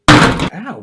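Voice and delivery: loud, high yelp of pain; quick pained cry; sharp ow. Real, not acted. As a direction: flinch out loud. Pain from penalty.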